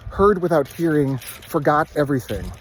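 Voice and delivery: plain reading voice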